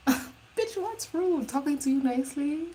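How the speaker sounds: high, laughing outrage; laughing disbelief. Real, not acted. Laughing back at the rude shopper in disbelief.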